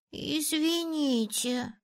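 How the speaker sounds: high, slow, small squeak; squeaky little boy. A narrator's reading. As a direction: squeak a timid, drawn-out apology. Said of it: "пропищал младший". (пропищать по-детски)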